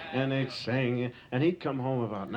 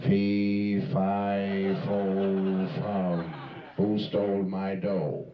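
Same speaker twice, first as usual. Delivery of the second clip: lower, louder, slow giant chant; giant's roar; 5 semitones lower, 3 dB louder, much slower than usual. Retold or imitated. Father finds his pockets empty and booms like the giant. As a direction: boom it out like a storybook giant.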